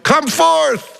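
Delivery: high, stretched call at normal volume; mock yell. Preacher imagines Jesus calling into a graveyard, mock yell. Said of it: "if he would have just yelled".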